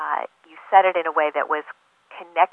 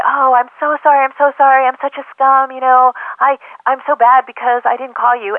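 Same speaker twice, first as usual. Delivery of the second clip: high, loud overdone apology; a hammed-up example of someone groveling to a friend over a missed call; mock groveling; 7 semitones higher, 5 dB louder than usual. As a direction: grovel pathetically for forgiveness, hammed up. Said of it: "they don't need to grovel".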